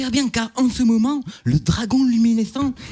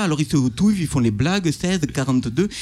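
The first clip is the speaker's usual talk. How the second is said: normal talking voice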